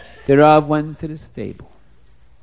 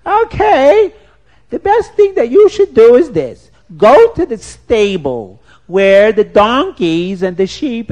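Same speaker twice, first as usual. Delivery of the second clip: shrill woodcutter's wife; 4 semitones higher, 5 dB louder than usual. Acted. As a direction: shoo the stranger off in a high, loud voice. Woman voice in told story.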